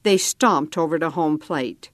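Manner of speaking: plain reading voice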